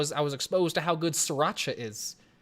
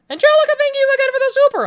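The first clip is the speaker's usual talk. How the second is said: excited shout of thanks; 11 dB louder, faster than usual. Real, not acted. Thanking a donor.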